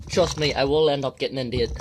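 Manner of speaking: loud, strained promise; shouting through the cold. Real, not acted. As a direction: push it out loud against the cold. Loud in icy water.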